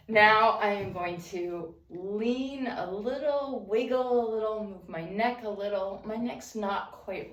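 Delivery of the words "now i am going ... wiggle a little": ordinary talk, nothing marked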